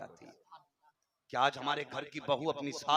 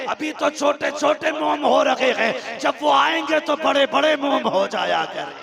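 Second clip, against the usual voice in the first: climactic proclaimed prophecy; 11 semitones higher, 9 dB louder than usual. Acted. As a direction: cry out the prophecy at full force. The stone's prophecy, preached at climax.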